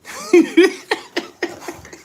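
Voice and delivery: sudden high unvoiced cackle; wheezing mocking laugh; high breathless laugh. Real, not acted. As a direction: burst out laughing at him. Bursts out laughing at him.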